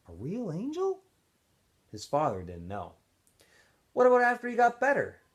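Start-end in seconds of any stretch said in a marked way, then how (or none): 0.1-1.0 higher, softer wondering question; young Stanley wondering. A narrator's reading. Young Stanley to his father, a soft wondering question. 3.9-5.2 higher, louder eager question; young Stanley asking. A narrator's reading. Young Stanley to his father, an eager higher question.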